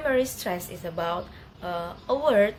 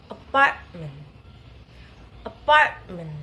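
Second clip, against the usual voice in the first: "apartment" with PART punched loud; light A, heavy PART in "apartment", faint ending; stress on second syllable; 4 semitones lower, 5 dB louder than usual. Shown as a demonstration. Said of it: "primary stress in the second syllable".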